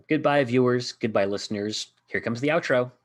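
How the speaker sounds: host closing out the show; outro announcer. Acted.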